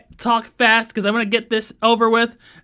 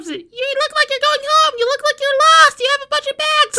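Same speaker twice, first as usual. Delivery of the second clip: very high put-on voice; high-pitched, insistent mock busybody; insistent driver; faster than usual. Driver insisting a pedestrian needs a ride, high-pitched.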